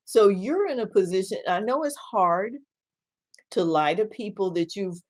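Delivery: ordinary talk, nothing marked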